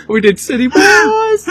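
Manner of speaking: high, slow, laughing; laugh-cry through it; crying with laughter. Real, not acted.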